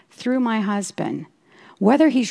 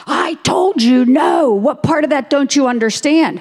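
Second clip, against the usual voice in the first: higher, louder, slow stern no; the husband snapping back, firm and impatient; stern husband's refusal; 7 semitones higher, 5 dB louder than usual. Retold or imitated.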